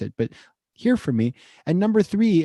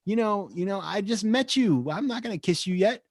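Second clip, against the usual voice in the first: mock coy refusal; 8 semitones higher than usual. Stranger in a bar turning down a kiss, coy and high. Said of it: "It's like".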